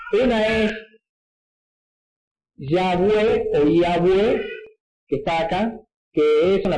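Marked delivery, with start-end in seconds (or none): 2.6-4.4 slow, careful Yahweh, Yahweh said as yah-weh; Yahweh demo. Shown as a demonstration. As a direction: say Yahweh slowly as Yahweh, with a w. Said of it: "dos posibles pronunciaciones".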